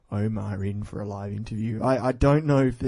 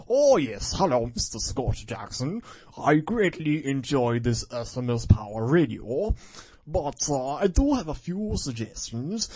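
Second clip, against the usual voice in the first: put-on corny English accent; 2 semitones higher than usual. Maxim replying to the host in a corny English accent. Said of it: "I could do my corny English accent".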